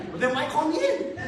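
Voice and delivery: mock indignant. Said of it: "I'm like".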